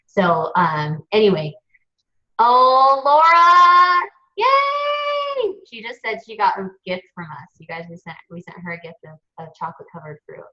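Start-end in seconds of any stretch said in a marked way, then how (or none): 2.4-5.5 sung-out high loud "Laura", squealed yay; excited cheer. Real, not acted. Greeting viewer Laura on the live webinar, singsong excited cheer. 7.6-10.4 much softer, half-confiding; quiet aside. Real, not acted. Telling viewers about Laura's gift, hushed aside.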